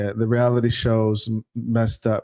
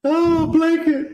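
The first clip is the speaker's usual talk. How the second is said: high, drawn-out mocking oh; amused disbelief; much slower than usual. Mocking the word blanket.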